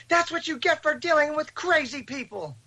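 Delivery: very high-pitched mock scolding yell; imitated mother yelling. His mother yelling about dealing with crazy people, imitated scolding. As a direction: mimic a mother scolding from out of sight. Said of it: "I hear his mother yelling".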